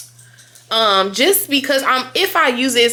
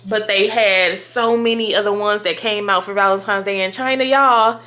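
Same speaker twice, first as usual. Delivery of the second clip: usual speaking voice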